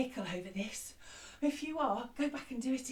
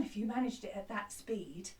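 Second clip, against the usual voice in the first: whisper; 6 semitones lower, 7 dB quieter, faster than usual. Real, not acted. Quick aside after fast round.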